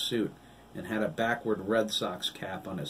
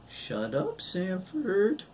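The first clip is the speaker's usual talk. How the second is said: ordinary delivery, nothing marked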